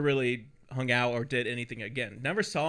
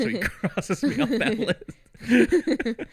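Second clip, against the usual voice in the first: laughing; 7 semitones higher, 4 dB louder, much slower than usual. Real, not acted. Laughing mid-sentence.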